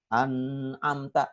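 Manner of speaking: drawn-out "An'amta" with a humming "n"; wrongly added ghunnah. Retold or imitated. Ustadz imitating the tested learner's error, long nasal n in "An'amta". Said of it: "Nggak begitu".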